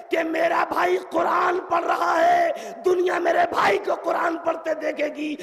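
high, loud oratorical shout; impassioned cry. Acted. Zainab answers Sahl about her brother, high impassioned shout. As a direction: cry it out on a sustained high pitch.

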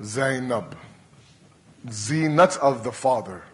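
soft, low, lingering on the name; hushed, loving call to a daughter; tender call. Acted. Tenderly calling daughter Zainab.

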